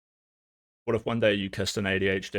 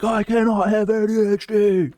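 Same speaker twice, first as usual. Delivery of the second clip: much higher, much louder indignant rant; denial-filled homophobic parent; 9 semitones higher, 11 dB louder than usual. Retold or imitated. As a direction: shout it like an outraged bigoted parent. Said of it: "Where you're like".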